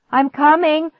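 high, loud answering call; yell. A narrator's reading. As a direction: yell back urgently. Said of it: "I yelled".

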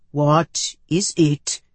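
slow, deliberate alien question; probing alien. A narrator's reading. Medus questioning her from the doorway, probing. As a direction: space the words out like an otherworldly interrogator.

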